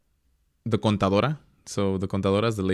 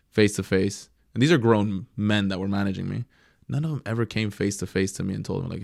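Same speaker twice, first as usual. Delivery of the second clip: normal talking voice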